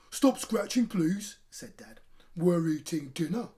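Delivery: dad snaps back at dinner; dad's fed-up telling off; scolding dad. Acted.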